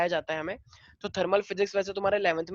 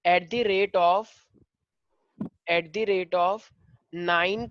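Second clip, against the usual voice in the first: very slow dictation with long pauses; question dictation; much slower than usual. Real, not acted. Teacher dictating a physics question to the class, slow dictation. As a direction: dole out the question in small pieces, slowly.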